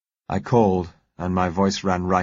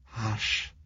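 softer, hushed soothing; calm her with a soft hush; gentle hush; 2 semitones higher, 7 dB quieter than usual. A narrator's reading. Bill soothing tearful Josella, soft hush. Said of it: "I said gently".

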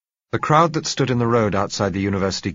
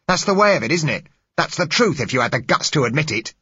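loud, high, building scorn; contemptuous accusation; 9 semitones higher, 6 dB louder than usual. Acted. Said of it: "He stood glaring".

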